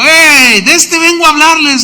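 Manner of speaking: exclamación aguda y fuerte; proclama entusiasta actuada. Acted. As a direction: anunciar un hallazgo a la multitud. Pablo anuncia su hallazgo, actuado. (entusiasmo de predicador que descubre algo)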